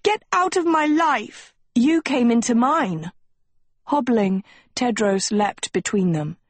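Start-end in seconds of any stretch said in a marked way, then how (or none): 0.0-3.1 high, angry back-and-forth; heated quarrel. A narrator's reading. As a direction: trade furious lines in a raised pitch. Quarrel peaks between the two girls.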